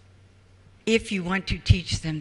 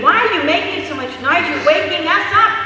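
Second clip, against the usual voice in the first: higher, louder, fast angry neighbor through the wall; neighbors yelling; 8 semitones higher, 5 dB louder, faster than usual. Retold or imitated. Neighbors pounding on the wall, fast loud yelling. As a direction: shout like a fed-up neighbor at night.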